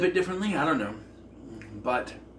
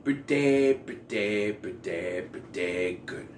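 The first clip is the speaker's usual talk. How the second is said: drawn-out pretty good; 6 semitones lower, 3 dB quieter, slower than usual. Real, not acted. Drawn-out verdict.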